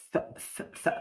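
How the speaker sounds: ث- a short voiceless th hiss; th sound drill. Shown as a demonstration. Teacher drilling the th of growth. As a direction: hiss ث- tongue between teeth. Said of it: "نضوج growth".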